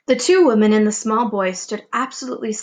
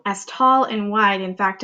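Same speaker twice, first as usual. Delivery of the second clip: ordinary delivery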